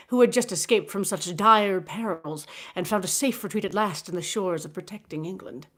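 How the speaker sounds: usual speaking voice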